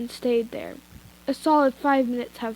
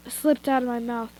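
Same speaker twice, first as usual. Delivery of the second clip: usual speaking voice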